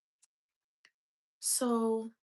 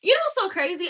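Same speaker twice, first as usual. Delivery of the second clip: excited; 9 semitones higher, 9 dB louder than usual. Real, not acted. Lively aside.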